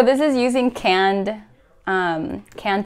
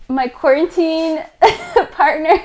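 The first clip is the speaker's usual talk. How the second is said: laughing; 8 semitones higher, 5 dB louder than usual. Real, not acted. Jokingly introducing her husband.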